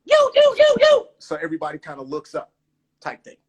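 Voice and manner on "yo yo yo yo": loud, very high repeated yelps; alarm call. Shown as a demonstration. Skater alerting everybody to a fall, alarm-like yelps. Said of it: "It's an alarm".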